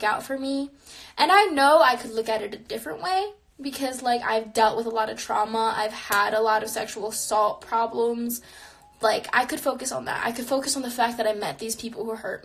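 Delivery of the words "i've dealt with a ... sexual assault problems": ordinary talk, nothing marked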